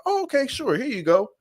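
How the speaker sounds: high, breezy sarcastic offer; mock easy giving up. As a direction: hand the baby over far too cheerfully. Mother handing over her child.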